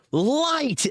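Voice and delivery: very high shrill shout; shrill scream. A narrator's reading. Hobbit screams at dwarves for light, shrill. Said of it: "screamed out ... shrill voice".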